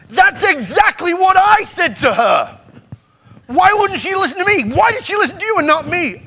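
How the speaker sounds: furious father. Retold or imitated. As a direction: shout it, wounded and furious. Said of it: "It made him more pissed off".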